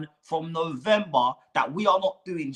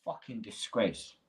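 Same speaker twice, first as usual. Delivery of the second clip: much lower and softer muttered disgust; 10 semitones lower, 11 dB quieter than usual. Real, not acted. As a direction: mutter it under your breath in despair.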